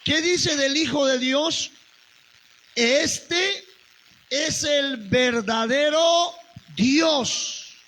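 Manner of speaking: ringing pulpit voice; preaching at full force. Acted. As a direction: proclaim it to the congregation. Preacher proclaims Jesus is God.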